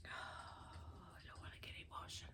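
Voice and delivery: near-silent rushed whisper, holding back tears; whispered aside trying not to break down; emotional hush. Real, not acted. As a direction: hush it to herself, fast, fighting emotion. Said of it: "I feel very emotional right now".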